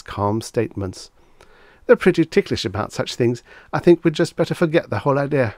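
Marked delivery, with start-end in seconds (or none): 1.9-5.5 high, fast worried pleading. A narrator's reading. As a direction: hurry it out, worried. Reggie beginning really to worry.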